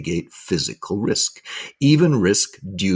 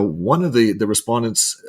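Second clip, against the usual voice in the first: usual speaking voice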